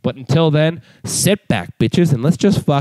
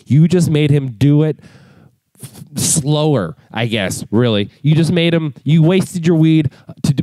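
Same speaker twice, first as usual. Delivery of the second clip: usual speaking voice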